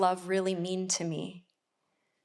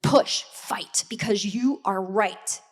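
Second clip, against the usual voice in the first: whispered urging from one half of her mind; whispered inner voice telling her to keep fighting. Retold or imitated. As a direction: hiss the push to keep fighting under the breath. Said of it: "one side was like".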